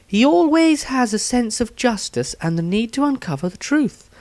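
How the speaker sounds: character diary voice. Acted. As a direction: read it as her own eager diary entry.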